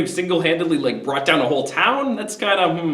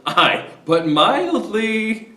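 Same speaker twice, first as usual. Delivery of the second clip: slow drawn-out mildly, pitched up; coaxing reminder; 5 semitones higher, much slower than usual. Retold or imitated. Old friend reminding Coriolanus to stay calm, drawn out. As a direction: stretch mildly out like a warning. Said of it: "Menenius goes".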